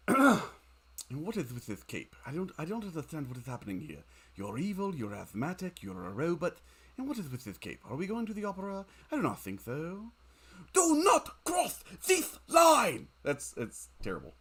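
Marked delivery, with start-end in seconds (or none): 0.1-10.1 hint of a fussy, puzzled villain; Hank Azaria. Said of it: "is Hank Azaria from Night at the Museum". 10.7-13.1 much higher, louder, slow hammed-up villain shout. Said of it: "That's a terrible impersonation".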